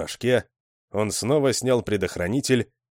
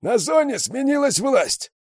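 much higher, loud hoarse voice over the radio; raspy radio voice; 4 dB louder than usual. A narrator's reading. Kruger answers over the radio, loud and hoarse. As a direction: crackle it like a hoarse con on the radio. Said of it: "сиплый голос".

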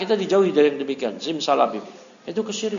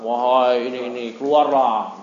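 lightly voiced summoning chant; mock spell caster; 5 semitones lower than usual. Teacher retelling Aladdin summoning the jinn, lightly acted. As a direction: coax the genie out of the lamp. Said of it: "dia ngucap mantra-mantra".

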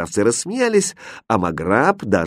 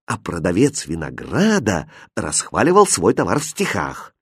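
ordinary delivery, nothing marked

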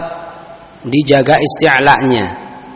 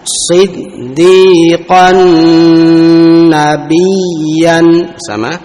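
slow chanted Quran recitation; much slower than usual. Shown as a demonstration. Teacher leading the class in chanted recitation. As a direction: draw out each word in chanted tajwid.

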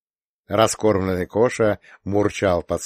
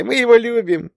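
put-on very high, loud child's cry; a little girl shouting her love; yelling child; 6 dB louder than usual. A narrator's reading. Little Leya shouts her love for her dad.

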